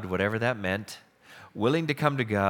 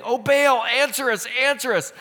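pleading cry to Baal; 10 semitones higher, 5 dB louder than usual. Retold or imitated. Prophets call on Baal.

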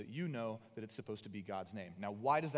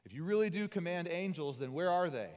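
louder taunting jeer; mocking shout; 3 semitones higher, 6 dB louder than usual. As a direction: jeer up at him from the crowd. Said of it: "people are screaming at him".

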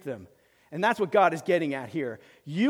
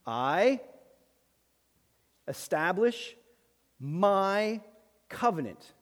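slow reading; much slower than usual. Shown as a demonstration. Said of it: "read it slowly".

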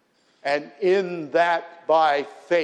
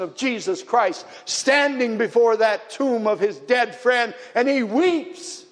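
higher, rising preacher's fervour; impassioned preaching; 8 semitones higher, 3 dB louder than usual. Acted. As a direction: preach it with mounting passion. Preaches about Jesus weeping.

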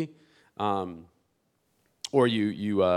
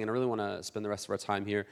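usual speaking voice

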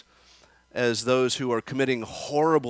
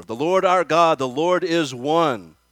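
loud, ringing proclamation of the creed; slow solemn recital at full voice; proclaiming the Shema; 4 semitones higher, 7 dB louder than usual. Retold or imitated. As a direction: declare it like a creed in a crowd. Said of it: "I can say the great Shema".

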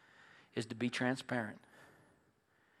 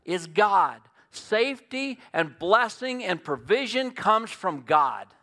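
loud, high, driving sermon delivery; preacher building to a loud climax; full-force preaching; 5 semitones higher, 8 dB louder than usual. Acted. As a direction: hammer every "It's not" home from the pulpit.

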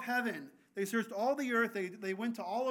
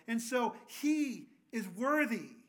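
ordinary delivery

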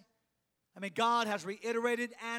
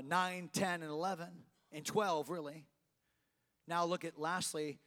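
usual speaking voice

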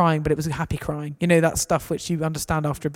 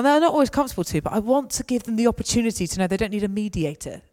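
usual speaking voice

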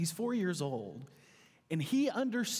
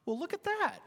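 put-on much higher, wide-eyed child voice; innocent little boy; 11 semitones higher than usual. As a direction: play the innocent kid pretending to be amazed. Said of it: "he goes".

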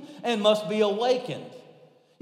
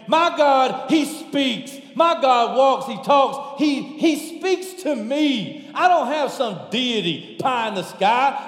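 raised, punchy preacher's cadence; impassioned preaching; 3 semitones higher, 3 dB louder than usual. Real, not acted. Preacher testifies to the congregation about his living God, impassioned and punchy. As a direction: drive each phrase home loudly.

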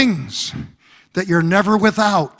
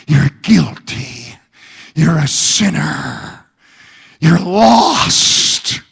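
much lower, slow, menacing murmur; Satan whispering; 5 semitones lower, slower than usual. Acted. Preacher voicing Satan to the congregation, low menacing whisper. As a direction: hiss the lies like a tempter in the ear. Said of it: "He whispers".

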